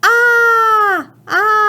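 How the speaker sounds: high, held "ah" sounds; teacher stretching a yelled "ah"; sounding out a scream. Shown as a demonstration. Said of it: "So I would tap that out".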